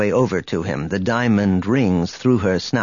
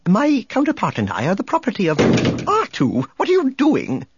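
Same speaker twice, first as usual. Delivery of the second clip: high prim voice, rising to alarm; fussy protocol droid; 9 semitones higher than usual. Acted. Droid scolding his banging companion, fussy and high. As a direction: state it primly, then scold in a high pitch. C-3PO interrupted by R2's banging.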